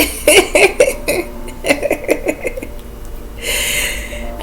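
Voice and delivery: long, high, breathy laughter; high giggling fit. Real, not acted. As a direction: giggle helplessly until breath runs out. Overjoyed after quoting the praise.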